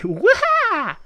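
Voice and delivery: loud, squealed "wah"; imitated silly creature cry; high rabbid yell. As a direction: shriek like a cartoon critter. Imitates rabbit hitting block.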